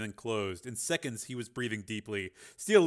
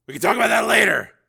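urgent father; 11 semitones higher, 14 dB louder, faster than usual. A narrator's reading. Father deflects.

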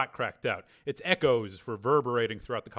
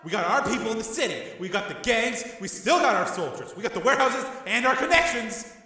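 loud, much higher wild rant; 12 semitones higher, 7 dB louder than usual. A narrator's reading. Tommy ranting wildly at his brother. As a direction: rant out of control. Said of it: "his eyes wild".

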